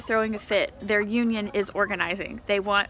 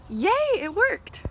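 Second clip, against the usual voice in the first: delighted cheer; 10 semitones higher, 3 dB louder than usual. Real, not acted. Frost cover saved beans.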